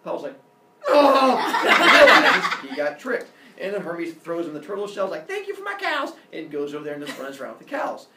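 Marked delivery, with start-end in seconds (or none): 0.9-2.6 long, very loud, high cry; outraged bellow. Acted. Apollo realising he got tricked, outraged bellow. 5.3-6.1 high-pitched singsong parting call; gleeful thanks. Acted. Baby trickster leaving with the cows, gleeful. Hermes gloats, running off.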